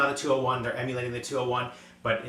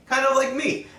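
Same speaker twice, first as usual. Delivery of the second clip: self-mocking joke; 7 dB louder than usual. Real, not acted. Joking that he is old too.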